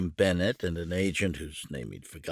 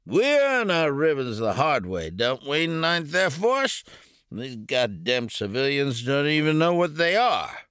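put-on loud, high drunken taunt; boastful drunk officer; drunk major; 7 semitones higher, 7 dB louder than usual. A narrator's reading.

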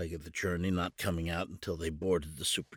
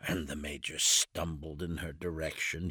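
ordinary delivery, nothing marked

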